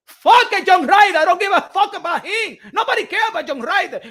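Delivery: loud, high, dismissive cursing; angry outburst. Real, not acted. Boxing fan rages at Canelo's weak opponent choice. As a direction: blow up in disgust at the name.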